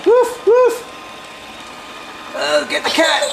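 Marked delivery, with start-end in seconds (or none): 0.1-0.9 high, punchy pretend woofs; dog bark imitation. Person playing the dog to tease the cat, high barking.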